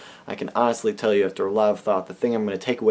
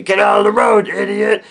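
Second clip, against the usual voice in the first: shouted, much higher and louder; angry driver yell; 11 semitones higher, 9 dB louder than usual. Retold or imitated. Honking driver yelling at them in the road. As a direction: bark it out the car window.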